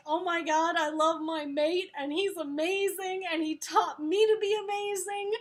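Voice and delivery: giddy, hammed-up adoration; gushing empowered quote. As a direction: hype herself up about her mate. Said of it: "empowering ... sort of moment".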